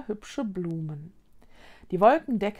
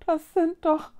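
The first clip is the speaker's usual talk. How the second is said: higher, halting realization; stammering, startled; 7 semitones higher than usual. A narrator's reading. Girl recognises the floating ghosts, faltering. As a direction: falter as you recognise them. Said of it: "stotterte Mila".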